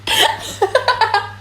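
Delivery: high burst of helpless laughter; high-pitched fit of giggles. Real, not acted. Reader cracks up.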